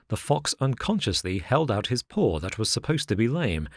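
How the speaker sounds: ordinary delivery, nothing marked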